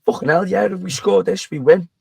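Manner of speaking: put-on a touch of drunken disbelief; excited realisation. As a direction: blurt it out as the penny drops. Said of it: "retold again, excited".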